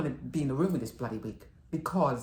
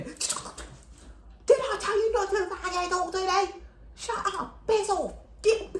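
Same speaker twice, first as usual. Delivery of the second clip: much higher, slow, yelling grump; furious mock shout; slower than usual. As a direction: bellow at the nosy neighbour. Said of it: "he stops his wheelchair".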